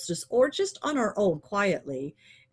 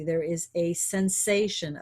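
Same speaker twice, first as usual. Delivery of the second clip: ordinary talk, nothing marked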